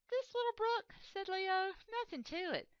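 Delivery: a very high, loud, breezy boast; boastful lion. Acted. Lion brushes off the mouse's worry, very high and boastful. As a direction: brush the river off, showing off.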